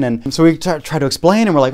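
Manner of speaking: usual speaking voice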